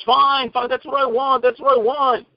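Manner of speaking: a director waving him on, pleased; director's voice. Retold or imitated. Director Herschel voiced again.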